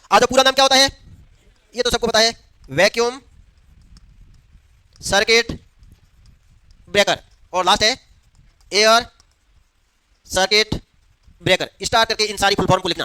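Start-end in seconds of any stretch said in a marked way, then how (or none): 2.7-7.2 long pauses between the words; spaced out for note-taking; word-by-word dictation. Real, not acted. Dictating a term for students to write. 8.7-11.7 long pauses between the words; spaced out for note-taking; word-by-word dictation. Real, not acted. Dictating a term for students to write.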